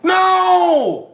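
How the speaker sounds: mock scream; mock anguished shout. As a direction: yell out in hammed-up regret. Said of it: "start going".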